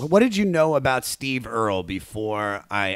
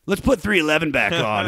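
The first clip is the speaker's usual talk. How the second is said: retold group demand; 5 semitones higher, 5 dB louder than usual. Friends' reply acted out in story.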